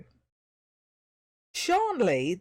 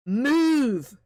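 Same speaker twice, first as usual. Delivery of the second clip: a sharp, loud, higher order; shouted command; 6 semitones higher, 8 dB louder than usual. A narrator's reading. Voice in his head orders the troll: loud command. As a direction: bark the one-word order. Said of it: "came the new command".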